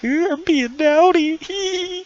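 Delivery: very high, drawn-out falsetto; tiny falsetto voice of naughty delight; giddy squeal. Acted. Acting out sneaky thrill on webcam.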